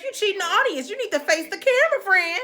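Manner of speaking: high-pitched, needling mock advice; retold taunt. Prisoner to panicking judge on camera, teasing. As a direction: sing it out to needle him.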